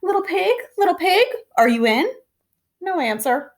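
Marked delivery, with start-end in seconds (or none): 0.0-2.1 calling out. Retold or imitated. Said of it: "so I called".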